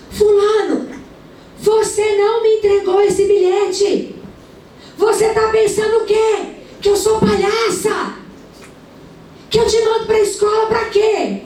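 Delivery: mother yelling at her son over a school note; retold yelling mother. A mother on the balcony yelling at her son, high shrill scolding. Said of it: "E ela gritando com o menino".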